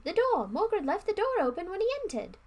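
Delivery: high, loud rush of hope; excited. Acted. Brother urging his sister toward the door, excited and high. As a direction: point it out thrilled. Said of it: "he whispered excitedly".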